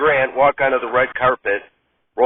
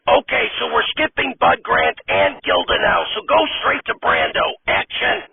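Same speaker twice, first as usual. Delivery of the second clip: much higher, whiny director voice; fussy director; 7 semitones higher than usual. Acted. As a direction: play a fed-up, high-pitched director. Director moves on.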